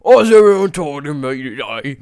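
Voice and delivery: put-on loud English-accented complaint. England put out by the phone call, English accent. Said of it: "the accent's amazing".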